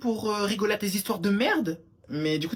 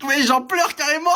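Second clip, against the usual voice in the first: loud, higher and laughing; laughing shout; 12 semitones higher, 6 dB louder than usual. Real, not acted. Laughing loudly at the crying interviews. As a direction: crack up mid-rant.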